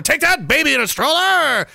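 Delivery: high, gleeful mock taunt; hammed-up attacker cheering; mock attacker gloat. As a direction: gloat like a cartoon villain.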